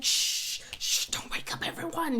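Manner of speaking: hushed shushing whisper. Retold or imitated. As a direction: hush him so the house sleeps. Hushing son at night.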